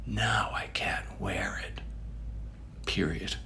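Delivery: hushed, whispered aftermath; dramatic whisper. Acted. Reviewer mourning shrunken clothes, dramatic whisper. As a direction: drop to a stunned stage whisper.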